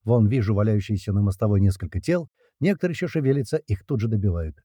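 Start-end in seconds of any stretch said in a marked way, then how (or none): none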